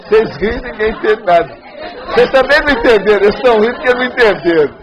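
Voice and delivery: high, loud mock scolding; loud teasing complaint. Real, not acted. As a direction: call out the laughing audience loudly. Teasing the audience for not understanding.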